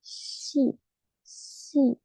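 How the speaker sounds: "Shi" said with a soft hushing sh, quiet and breathy; teacher modelling "Shi"; pronunciation demo. Shown as a demonstration. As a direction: model "Shi" slowly as a shh plus i. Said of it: "ustedes hacen ese shh, simplemente le agregan la I".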